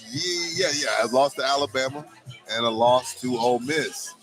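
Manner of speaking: ordinary delivery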